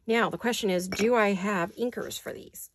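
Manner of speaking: ordinary delivery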